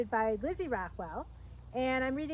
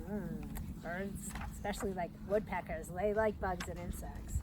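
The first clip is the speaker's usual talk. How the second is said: put-on a soft whispered aside to the listening children; hushed aside; 6 semitones lower, 6 dB quieter than usual. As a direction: lower and softer, half whispered. Quiet aside about woodpeckers.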